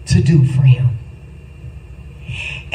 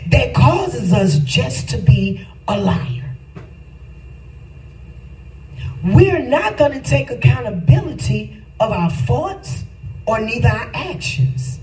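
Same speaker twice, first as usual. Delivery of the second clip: higher, building sermon cry into a sung shout; preaching at full force; 5 semitones higher than usual. Acted. Preacher calling his congregation to come clean, rising sung shout.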